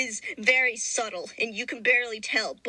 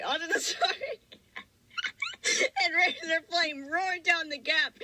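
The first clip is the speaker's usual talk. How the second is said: still giggling; 6 semitones higher than usual. Real, not acted. Still laughing after the fit.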